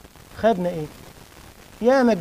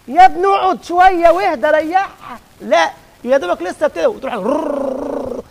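high, loud plea to sit down and calm; loud mock scolding, high and slow at the end; urging loud plea; 8 semitones higher, 8 dB louder, slower than usual. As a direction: call out to a restless boy, begging him to settle. Speaker acts out urging the restless worshipper.

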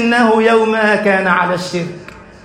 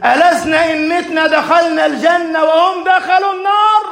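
a hurt, angry appeal to the Prophet; angry protest; 7 semitones higher than usual. Acted. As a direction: Umar bursting in on the Prophet, angry and calling out. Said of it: "غاضبا".

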